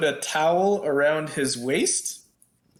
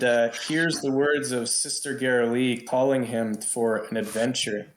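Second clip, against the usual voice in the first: usual speaking voice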